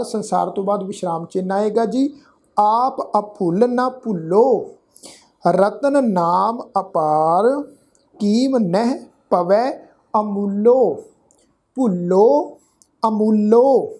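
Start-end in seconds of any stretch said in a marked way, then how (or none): 2.6-13.9 slow Gurbani reading, each word drawn out; measured scripture recitation. Shown as a demonstration. Teacher showing the congregation how to read the verse, slow measured recitation. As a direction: recite deliberately with pauses between words. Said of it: "ਇਸ ਢੰਗ ਨਾਲ ਇਹਨਾਂ ਦਾ ਪਾਠ".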